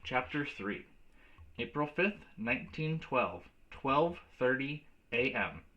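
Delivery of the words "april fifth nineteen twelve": ordinary delivery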